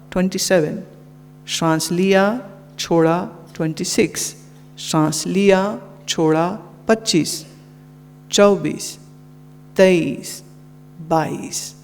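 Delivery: soft, measured countdown with long pauses; guided breath counting. Shown as a demonstration. Yoga teacher guiding students through reverse counting, soft and measured.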